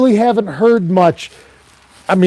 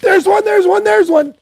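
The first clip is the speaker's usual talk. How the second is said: much higher, rapid excited calling out; excited spotting fish. Retold or imitated. Angler retelling spotting fish to his lounging buddy, excited high call. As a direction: squeal it like you just spotted a fish. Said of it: "when I do go".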